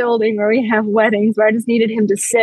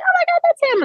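much higher mock shriek of recognition; oh my God; faster than usual. Friends recognise their drunk pal, high squeal. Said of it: "They're like".

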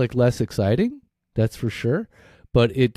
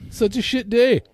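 much higher, mock-exasperated outburst; exasperated self-complaint; 13 semitones higher than usual. Hungry speaker bemoans the day, mock exasperated. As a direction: play up your own cluelessness, pitched high.

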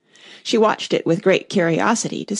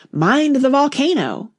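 high, slow queenly warning call; 6 semitones higher, 3 dB louder than usual. A narrator's reading. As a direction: call a drawn-out warning in raised pitch.

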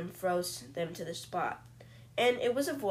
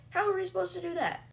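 much higher, fast whiny voice; mock whiny friend; 11 semitones higher, faster than usual. Friend objecting to escaping prison, in a high whiny voice. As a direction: whine it like a helpless sidekick.

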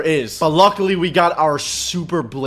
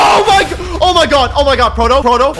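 high, loud cry of alarm; panicked shout; 7 semitones higher, 6 dB louder than usual. Real, not acted. Gamer startled by the boss, panicked shout. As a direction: shout for your companion in sudden panic.

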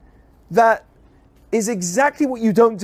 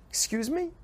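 high rising excuse me; mock disbelief; incredulous retort; 7 semitones higher, 11 dB quieter than usual. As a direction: sound affronted. Retort to accusers.

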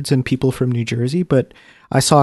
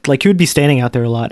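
ordinary talk

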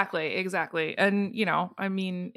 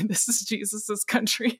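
laughing; 2 semitones higher than usual. Real, not acted. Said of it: "I said I wouldn't laugh".

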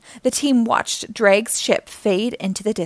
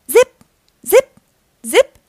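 short high, loud zips; laser zaps; 11 semitones higher, 8 dB louder than usual. A narrator's reading. Turtles' lasers blast Robugs, sharp high zips. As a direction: fire off separate high zaps. Imitates ship lasers firing.

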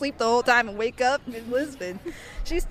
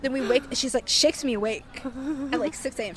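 normal talking voice

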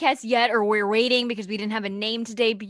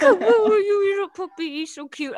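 puppy talk voice; 10 semitones higher, 4 dB louder than usual. Real, not acted. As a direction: coo it sweetly like to a puppy. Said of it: "my puppy talk voice".

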